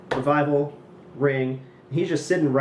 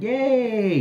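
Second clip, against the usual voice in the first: mock cheer; 10 semitones higher, 4 dB louder than usual.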